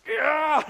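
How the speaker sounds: loud, high acted holler; hammed-up thrill scream; wild ride yell. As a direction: yell like you're being flung around. Acts out riding the crazy ride.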